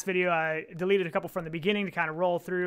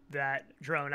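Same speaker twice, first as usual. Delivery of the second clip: ordinary talk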